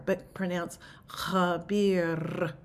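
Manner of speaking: "khabir" with a rolled R and breathy KH. Shown as a demonstration. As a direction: say "khabir" slowly, rolling the R. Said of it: "a little roll of the R".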